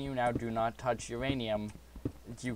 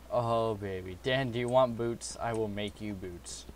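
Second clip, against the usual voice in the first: usual speaking voice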